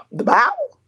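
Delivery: high incredulous squeak; can't believe what she heard; shocked disbelief. Real, not acted. Incredulous repeat, much higher.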